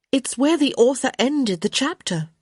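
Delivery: put-on high-pitched, unrepentant young woman. A narrator's reading.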